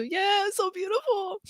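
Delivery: high, slow tearful coo; high, touched and weepy; happy crying. Real, not acted. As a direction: draw it out, moved to tears. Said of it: "I'm crying now too".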